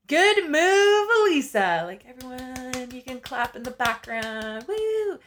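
high, loud self-congratulation opening, drawn out and ending in a whoop; mock cheering. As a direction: play your own cheering crowd. Mock applause for her own decision.

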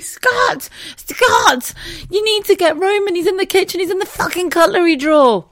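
high, frantic yell for help; desperate calling out. Retold or imitated. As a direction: call urgently to a partner in another room. Retold desperate call from the toilet.

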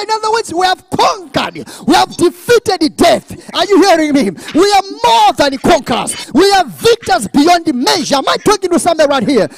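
high, loud, triumphant exhortation; preaching at full force. Real, not acted. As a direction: fire up the congregation with driving conviction.

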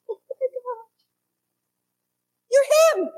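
high, loud sudden recognition; astonished. Retold or imitated. Peter realising that Jesus is the Messiah, astonished. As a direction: gasp it out in awe. Peter's revelation moment voiced.

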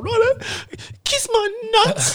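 put-on very high, slow, smug kid voice; bratty little brother. Little brother demands payment from Chris, high and smug. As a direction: drag it out like a bratty kid naming his price. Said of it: "He's like".

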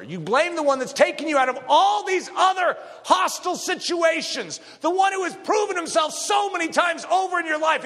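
rising sermon shout; preaching at full force. Real, not acted. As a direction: drive it home from the pulpit.

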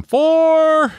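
long, very high holler; prank yell. Retold or imitated. Kids yelling at golfers from a passing car, loud high holler. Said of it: "we'd yell at 'em".

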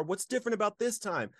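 angry man shouting at God in his car; retold yelling. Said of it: "yelling at him in the car".

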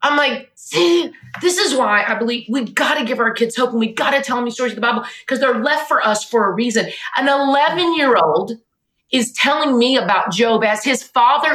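high, tearful, impassioned voice; overcome with emotion. Real, not acted. Mother moved by her son's faith as his father dies, tearfully overcome. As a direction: push the words out high, close to crying.

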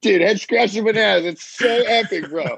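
high-pitched, cracking up; tickled, voice lifted by real laughter; laughing amusement. Real, not acted. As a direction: squeeze it out through laughter. Cracking up at the bananas line.

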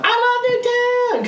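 high, slow gushing I love Nuketown; mock fanboy. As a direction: parody an eager kid voting. Mocking map voters.

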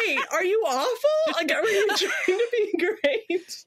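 high, drawn-out incredulous questioning; hammed-up confusion, pitched right up; baffled disbelief. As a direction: question him in mock bewilderment. Acted baffled reaction to him.

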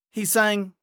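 ordinary delivery

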